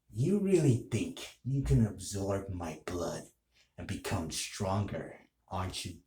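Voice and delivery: a lightly played dark hero sneering at a cornered demon; villain taunt. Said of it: "And this is what I would say".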